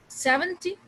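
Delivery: Seventy with high SEV; Seventy, strong start and light ty; stress on first syllable. Shown as a demonstration. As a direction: say Seventy as SEV-en-ty, short ending. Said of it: "second syllable पे".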